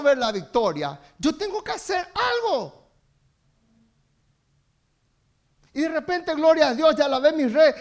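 loud, passionate sermon delivery; fervent preaching. Acted. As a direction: preach it out with full force to the church. Preaching to the congregation.

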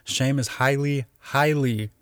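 "highly" said twice, each one leaned on; deliberate weight on both words "highly"; emphatic doubled HIGHLY. Shown as a demonstration. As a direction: land on "highly", then land on "highly" separately. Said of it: "she used the word highly twice".

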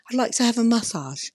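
put-on light British accent on massage, MA-ssage with a British lilt; a hint of a British accent saying "massage". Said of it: "They say".

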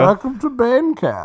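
a hint of a menacing villain; slow, high put-on villain voice; Bane voice. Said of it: "your Bane sounds like".

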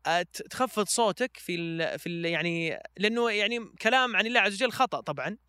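normal talking voice

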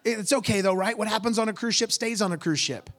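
high, loud imitation of her plea; insistent reassurance-seeking. Girl asking pastor to excuse it, insistent. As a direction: push it up, wanting a yes.

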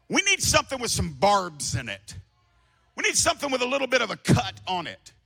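high, loud rising sermon voice; fiery pulpit cry; full-force preaching. Acted. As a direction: drive it home to the church.

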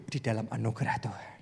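hushed whisper. Real, not acted. Preacher whispering the point to the congregation. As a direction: lean in and whisper it.

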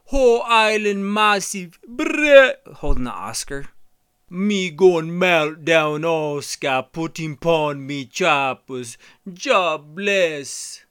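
loud Jamaican patois impression; Jamaican patois accent. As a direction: toast it in thick Jamaican patois. Imitating Chaz Hanks patois.

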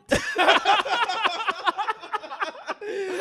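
high-pitched cackling laugh. Real, not acted. Laughs after boing.

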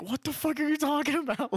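very high, incredulous outburst; baffled disbelief. Retold or imitated. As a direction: replay total bafflement, pitch shooting up. Retelling bafflement at repeated demand.

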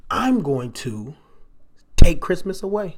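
slow build to a loud declaration; Grinch scheming. Retold or imitated. Preacher voicing the Grinch announcing his plan, building loud. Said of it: "He says".

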